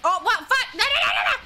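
loud, high burst of alarm; startled outburst. Real, not acted. Interrupted by something mid-stream.

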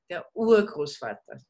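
Urgroßvater with the weight on the first syllable Ur; stress on UR. Shown as a demonstration. Teacher shows the class the first-syllable stress in Urgroßvater. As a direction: lean hard on Ur in Urgroßvater. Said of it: "el acento siempre es en la primera sílaba".

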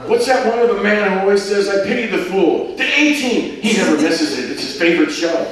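gushing tourist from Liverpool; Rose. Acted. Rose chatters about A-Team.

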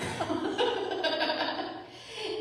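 bright rising laugh; high laugh. Real, not acted. Laughing at her own joke to the congregation, a high laugh. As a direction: let a high laugh burst out.

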